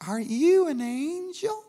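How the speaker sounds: high, slow wondering question; imitated awed woman. Voicing the wondering lady's question.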